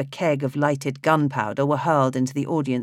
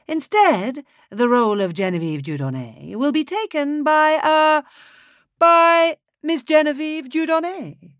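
much higher, louder, stumbling on uh; high, flustered conductor; 12 semitones higher, 4 dB louder than usual. Acted. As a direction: announce it with nervous flourish. Conductor announces new lead.

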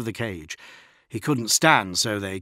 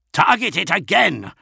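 higher, louder bellowed order; bellowing; 10 semitones higher, 8 dB louder than usual. A narrator's reading. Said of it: "bellowed Namet Jira".

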